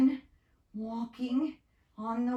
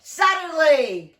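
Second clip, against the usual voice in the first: Suddenly boomed out loud and slow; loud dramatic suddenly; 5 semitones higher, 10 dB louder than usual. Shown as a demonstration. To the children, a big storyteller's boom. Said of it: "Okay, we'll try again".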